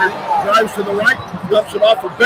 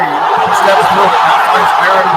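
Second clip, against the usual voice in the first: louder, rising with the play; excited play-by-play on a quick pass; excited call; 2 semitones lower, 8 dB louder, slower than usual. Real, not acted. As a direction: call the drive with a laugh in it. Live play-by-play, big play.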